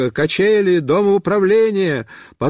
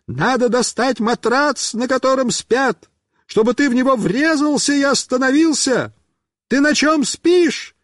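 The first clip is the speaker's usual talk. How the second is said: high-pitched calling voice; sustained high holler; shouting; 7 semitones higher than usual. A narrator's reading. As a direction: shout instructions to someone going past. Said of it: "Мишка кричит".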